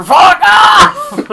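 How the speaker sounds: high, loud, drawn-out punchline; mock shout. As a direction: yell the translation, stretching the last words. Joke translation of the gunfire.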